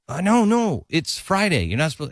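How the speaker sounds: high mock scold of a churchgoer; scolding reminder. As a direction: chide him playfully about Friday. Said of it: "somebody would say".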